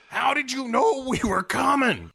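high put-on clueless voice; dopey detectives. Detectives questioning Sharon Stone, high dopey voice. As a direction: squeak it like a baffled cop. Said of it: "are like".

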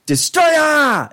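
"Destroyer" cried out as "Des-troy-AH"; hammed-up yell of "Destroyer", last syllable soaring; American-version pronunciation. Shown as a demonstration. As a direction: shout "Destroyer" with a long high "-yah" ending. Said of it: "It's Destroyer".

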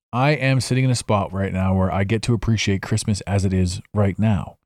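ordinary talk

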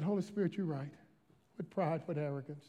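low, soft confession; hushed contrition. Retold or imitated. Pastor confessing to Holy Spirit, retold hushed contrition. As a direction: admit fault quietly, lightly re-enacted. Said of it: "I start to weep".

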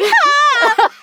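high shouted "ha" yelp; sharp loud "ha". Retold or imitated. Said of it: "and I'm like".

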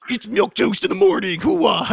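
loud, gruff radio-host Pacino. Retold or imitated. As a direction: bellow it like a hammy Pacino. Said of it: "make your voice gruff".

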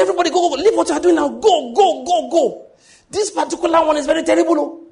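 high, loud frantic warning; urgent alarm. Retold or imitated. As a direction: shout them all out of the room in panic. Man warns everyone of meningitis.

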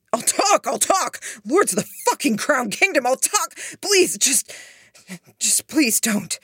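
high, loud, frantic begging; panicked pleading. A narrator's reading. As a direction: beg desperately to be spared. Threatened man begs to talk.

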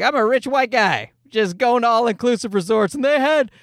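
rich guy impression. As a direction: play a pampered tourist, rising to a high finish. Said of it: "second one was like".

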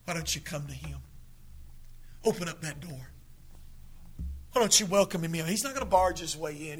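soft, low invitation; gentle altar call. Acted. Preacher inviting the lost to come, soft and pleading. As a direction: coax them gently to come forward.

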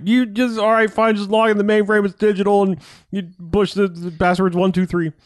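high, exasperated mock yelling. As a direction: rattle it off in a high, imitated yell. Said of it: "starts the uncontrolled yelling".

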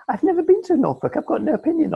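very high-pitched protest of innocence; protesting denial. Real, not acted. Accused co-host denies slagging Norfolk off, high and protesting.

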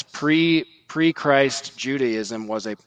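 ordinary talk

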